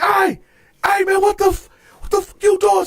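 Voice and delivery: high-pitched indignant challenge; alarmed confrontation. Retold or imitated. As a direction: act out an outraged protest. Retold confronting cellmate.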